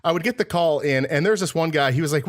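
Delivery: normal talking voice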